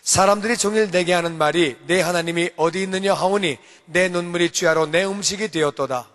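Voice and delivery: reading scripture aloud to the congregation; responsive scripture reading. Real, not acted. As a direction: read the verse out firmly, leading the room. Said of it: "우리 삼 절부터 교독해서 읽어봅니다". (성경 교독 낭독)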